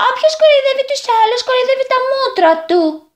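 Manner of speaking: put-on very high, fast angry girl's shout. A narrator's reading. Girl angrily shouts back at the boys, high. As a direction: snap it at them furiously. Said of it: "φώναξε με θυμό το κορίτσι".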